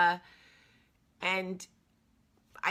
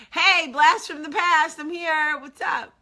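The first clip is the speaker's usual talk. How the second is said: loud, high, slow imitation of a thrilled friend; exuberant greeting; 9 semitones higher, 10 dB louder, slower than usual. Old friend greets a long-lost caller, imitated loud excitement. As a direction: belt out his big phone hello.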